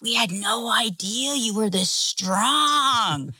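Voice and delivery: put-on drawn-out childlike awe; awestruck youngest child. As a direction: imitate a small child marveling at a parent. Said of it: "our youngest is like".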